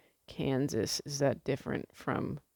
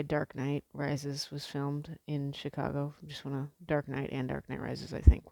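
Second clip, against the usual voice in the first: normal talking voice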